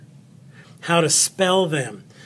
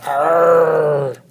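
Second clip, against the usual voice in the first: drawn-out pirate "arr", a bit louder; pirate growl; 4 dB louder than usual. Pretend. Teacher playfully reacting to the word pirate, pirate growl.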